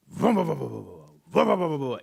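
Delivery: loud vroom of a bike engine; imitated motorbike revving. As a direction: mimic a motorbike starting.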